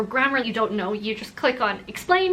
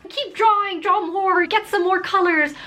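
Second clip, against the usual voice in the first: higher, bright cheering on; eager encouragement; 7 semitones higher than usual. Retold or imitated. Encouraging the five-year-old to keep drawing, eagerly. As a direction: cheer the kid on.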